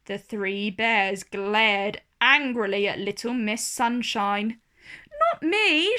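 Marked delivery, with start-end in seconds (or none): 5.3-6.0 higher, louder protest; crying out. A narrator's reading. Little Miss Sunshine cries to the bears that it wasn't her, high and louder. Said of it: "she cried".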